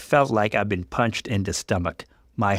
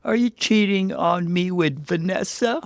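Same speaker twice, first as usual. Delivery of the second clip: tearful accusation; 10 semitones higher than usual. Acted. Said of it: "tears streaming down my face".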